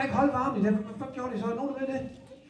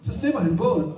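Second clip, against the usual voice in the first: ordinary delivery, nothing marked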